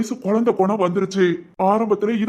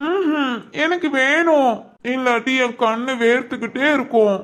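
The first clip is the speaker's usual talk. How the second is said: put-on higher, innocent childlike voice; childlike man; 7 semitones higher than usual. Regressed son to his caretakers, higher childlike voice. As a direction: talk like a puzzled ten-year-old.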